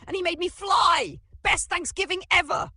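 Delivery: very high, loud cheer; proud elation. A narrator's reading. Uncle thrilled after flying, cheering proudly. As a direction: shout it in triumphant glee. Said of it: "Uncle Bingo flexed proudly".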